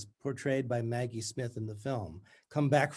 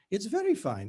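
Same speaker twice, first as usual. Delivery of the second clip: strangulated bright voice; 8 semitones higher, 3 dB louder than usual. Acted. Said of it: "In her strangulated voice".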